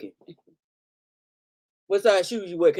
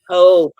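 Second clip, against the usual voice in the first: Hope punched much louder; emphatic hope; 2 semitones higher, 13 dB louder than usual. Real, not acted. Vlogger to viewers, stressing hope loudly. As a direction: hit the word Hope hard and loud.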